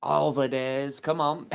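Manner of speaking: ordinary delivery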